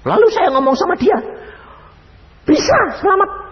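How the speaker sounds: high, loud eager announcing; excited high exclaim. Retold or imitated. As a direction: burst out with the surprising news. Memberitakan dengan semangat. (berseru tinggi penuh semangat)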